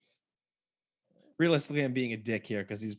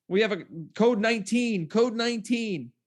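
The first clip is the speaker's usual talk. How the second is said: raised, urgent intercom call; mock announcement; 7 semitones higher, 3 dB louder than usual. Film staff calling the code, mock urgent. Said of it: "they go".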